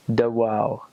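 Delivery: "dehors" with a W, like duh-wow; Quebec "dehors", pronounced duh-wow. Shown as a demonstration. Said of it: "they'll put a W in it".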